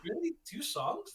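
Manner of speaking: high, incredulous "really, two songs"; mock disbelief. Recalling the church, high disbelief. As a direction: act stunned at the short set.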